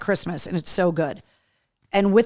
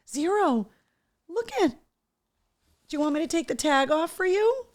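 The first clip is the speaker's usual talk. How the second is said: high pet talk; 7 semitones higher, 3 dB quieter than usual. Real, not acted. Talking to the dog.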